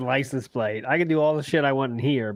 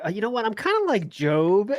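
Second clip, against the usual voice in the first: mock patient; 7 semitones higher than usual. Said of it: "be like".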